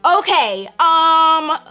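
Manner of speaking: loud, high, drawn-out hesitation; acted alarmed stalling. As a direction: act out stalling after bad news. Acted stunned reply to bleeding.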